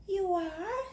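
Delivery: high, soft question; surprised little girl. Acted. Jamaica surprised by her teacher's words. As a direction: ask in happy surprise.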